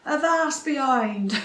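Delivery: put-on slow loud pirate accent; pirate phrase. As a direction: bark it at Wendy like a pirate. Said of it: "a few choice phrases like".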